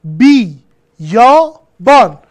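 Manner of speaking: بی، یا، بان as three loud, separate beats; syllable by syllable. Shown as a demonstration. Teacher proving the y syllable, syllable by syllable. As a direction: punch out بی، یا، بان one at a time. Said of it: "میگیم بیابان".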